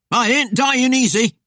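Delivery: high, loud defiant cry; roar. A narrator's reading. Cornered man defies Death at his door, roaring. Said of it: "he roared".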